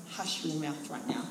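low, soft scolding hush; a crowd telling him to shut up; hushing someone. Retold or imitated. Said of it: "despite them going".

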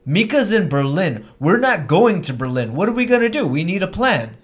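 nervous scene partner begging for a plan; anxious character. Acted. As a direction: fret like the plan has fallen apart.

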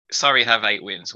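usual speaking voice

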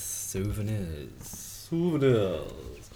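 disdainful. Acted. Mock-disdainful drawn-out word.

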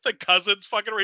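ordinary delivery

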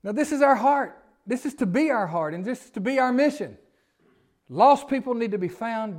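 loud, high, ringing pulpit voice; preaching at full force. Acted. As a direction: proclaim it to the whole room.

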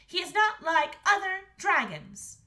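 put-on high, loud, emphatic girl; Princess Astrid. A narrator's reading. Princess defending the dragon to the king, determined. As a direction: insist on it, pitched high. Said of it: "said Princess Astrid".

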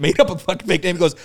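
ordinary delivery

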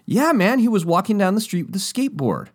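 high, keen, dude-speak insistence; eager kid insisting. Retold or imitated. Kid insisting to the skaters, high and keen. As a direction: double down with a bright high voice.

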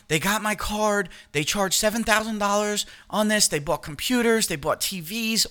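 a hint of a high, worried mother; mom retold. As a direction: lift the voice a bit for mom's worry.

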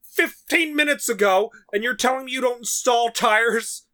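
reliving the rant at the clerk; retold yelling. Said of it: "I'm yelling at this guy".

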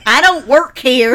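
hammed-up Texas accent. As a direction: call it out in an exaggerated Texas accent. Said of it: "No, I was doing Texas".

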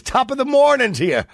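high, loud stage Irish greeting. Retold or imitated. Host mocking the Yank stage Irish greeting. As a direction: ham up the stage Irish cliché. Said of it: "you hear the phrase".

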